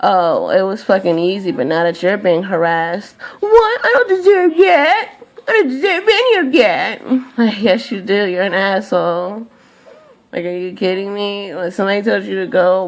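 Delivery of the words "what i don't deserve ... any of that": much higher, louder fake-sobbing whine; mock crybaby. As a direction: ham up a wounded crybaby protest. Mocking bullies playing victim.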